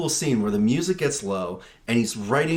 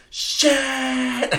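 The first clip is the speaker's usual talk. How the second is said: mock shriek; 12 semitones higher than usual. Characters crash into manure.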